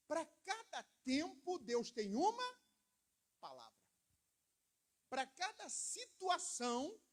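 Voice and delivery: slow, loud, rising emphatic lines; stretched preacher cadence; preaching rhyme. Acted. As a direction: drive each rhyme home to the church. Rhymed preaching lines, noted "até rimou.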